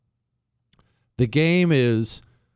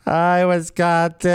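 louder, slow, drawn-out imitation; mock tipsy greeting; 4 semitones higher, 4 dB louder, slower than usual. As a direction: ape her sloppy sincere hello. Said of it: "She's gotta be hammered".

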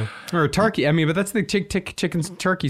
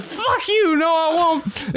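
much higher, whining like he's about to cry; high puppet whine, half sobbing; whiny puppet voice; 11 semitones higher, much slower than usual. Acted. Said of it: "And he said".

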